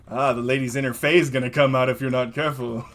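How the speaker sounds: laughing tease. Acted. Bartender teases drunk guest.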